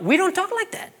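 mild parental reproach; a hint of a firm dad; stern parent. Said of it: "I'm just telling them".

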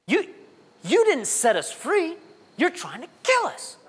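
high, drawn-out mock accusation; accusing complaint. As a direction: act out grumblers blaming God. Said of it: "they grumbled and complained".

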